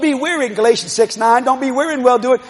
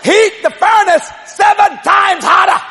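commanding shout; 8 semitones higher, slower than usual. Acted. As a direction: roar the king's command. King orders the furnace.

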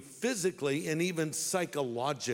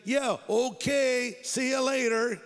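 higher, drawn-out brush-off; mock agreement; 7 semitones higher, 4 dB louder than usual. David puts off Saul's invitation, in mock agreement. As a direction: stretch it out as if humouring him. David humours Saul, keeping distance.

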